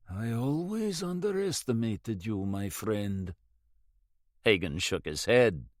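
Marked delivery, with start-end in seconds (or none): 0.1-3.3 soft, wry words from a half-frozen man; weak rueful words. A narrator's reading. Half-frozen Russian in the water to Hagen, soft and wry. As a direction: smile through the cold, softly. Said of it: "He managed to smile and said".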